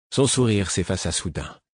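plain reading voice

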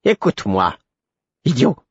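impatient fool, rising high on idiot; exasperated, high-ending rebuke; annoyed. A narrator's reading. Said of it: "où perçait l'agacement".

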